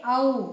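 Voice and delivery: औ said with the mouth wide open, gliding from अ to ऊ; demo vowel औ. Shown as a demonstration. Teacher contrasting औ with ओ for students, wide open vowel. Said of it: "इसका उच्चारण औ निकलता है".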